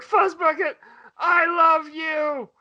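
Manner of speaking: high, very slow hammed-up wail; mock crying kid. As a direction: drag out I love you like a sobbing child. Crying kid confesses love.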